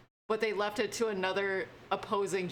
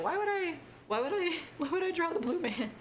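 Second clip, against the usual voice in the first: high, baffled protest; why would I, said up high in disbelief; baffled squeal; incredulous; 10 semitones higher than usual. Retold or imitated. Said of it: "I was like".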